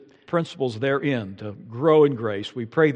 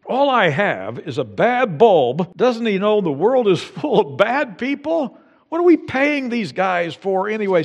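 griping; 6 semitones higher, 3 dB louder than usual. Retold or imitated. Driver griping about the police over a bulb, mock complaint. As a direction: grumble about the bad bulb as if wronged. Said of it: "perhaps there are many that do".

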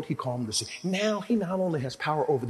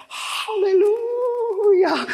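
much higher, louder, slow drawn-out cry; ecstatic shout; 8 dB louder, much slower than usual. Acted. Healed woman crying out her praise. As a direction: wail it to the heavens.

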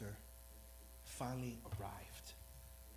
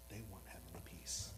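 hushed, whispered aside; softer whispered repeat of the line; 7 semitones lower, 7 dB quieter than usual. Real, not acted. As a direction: drop to a whisper for the lost. Contrasting everyone with the lost.

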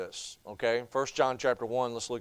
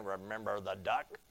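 low, hushed, knowing tease; sister's sly taunt; 7 semitones lower, 10 dB quieter than usual. Retold or imitated. Preacher voicing the sister blackmailing her brother, low and sly. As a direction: murmur it low like a sneaky little sister. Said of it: "She just keeps saying".

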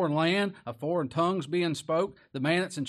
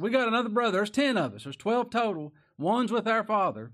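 pleading brothers; 7 semitones higher, 5 dB louder than usual. Acted. Brothers pleading, voiced loud.

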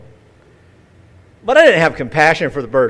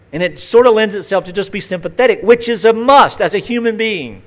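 higher and louder, building sermon fervor; fervent preaching; 7 semitones higher, 5 dB louder than usual. Acted. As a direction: preach it out, rising in pitch and force.

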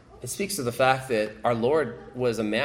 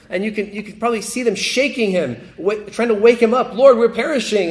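excited building shout; 9 semitones higher, 7 dB louder than usual. Acted. Shaking him awake.

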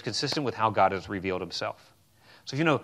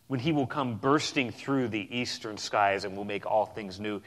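ordinary talk

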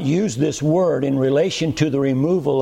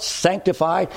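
ordinary delivery

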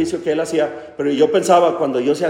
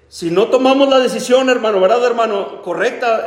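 raised, urgent exhortation; high, insistent preacher's voice; fervent preaching; 6 semitones higher, 3 dB louder than usual. Acted. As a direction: press the warning home from the pulpit. Preaching at full force.

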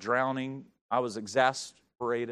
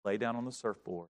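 calm; 6 semitones lower, 11 dB quieter than usual. Retold or imitated. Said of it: "just calm as he can be".

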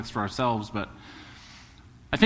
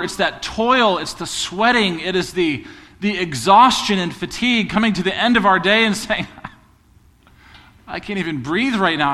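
louder, higher preaching, tired parent cry; preaching at full force; 7 semitones higher, 6 dB louder than usual. Acted. Pastor to congregation on labor, full-force preaching. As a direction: drive it home from the pulpit.

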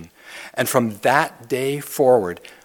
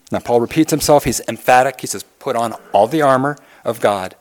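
louder, driving sermon delivery; fiery pulpit preaching; preaching at full force; 5 dB louder than usual. Acted. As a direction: press the scripture home like a rally cry. Preaches the armor passage.

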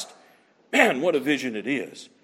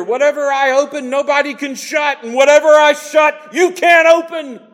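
high, loud proclamation of authority; preacher voicing Christ's command to the churches; proclaiming shout; 11 semitones higher, 5 dB louder than usual. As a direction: ring it out like a royal decree.

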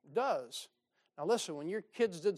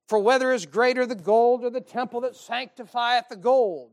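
loud, high thundering rebuke; fiery preaching; 5 semitones higher, 12 dB louder than usual. Shown as a demonstration. Jesus scolding the Pharisees, loud fiery preaching. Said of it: "That's what I hear".

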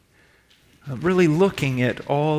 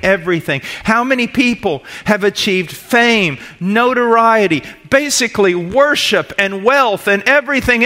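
preaching at full force; 6 semitones higher, 7 dB louder than usual. Acted. Preacher building to the crowd, loud and high. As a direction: preach it out to the congregation.

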